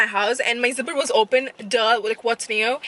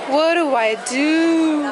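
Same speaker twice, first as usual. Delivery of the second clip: mock despair; 3 semitones higher, 5 dB louder, much slower than usual. Real, not acted. Store lacks her bronzer.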